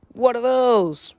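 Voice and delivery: high loud slow "what are those"; shocked meme voice. Acted. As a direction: gasp it like the meme. Meme quote.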